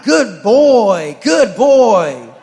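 loud, high praise for a dog; dad cheering his dog mid-business; cheerleading the dog. Acted. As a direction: cheer like a proud coach. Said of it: "a cheerleader".

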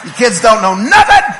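loud, mock-triumphant put-down; gloating shout. Dad gloating at his kids, loud mock triumph. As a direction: crow it loudly at the losers.

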